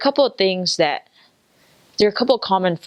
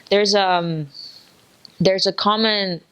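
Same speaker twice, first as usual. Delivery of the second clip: ordinary delivery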